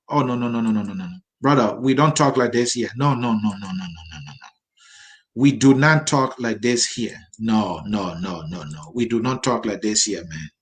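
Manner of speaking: low, repeated no's dying away; disapproving. Real, not acted. Host rebuking a viewer for disrespectful comments, low disapproval.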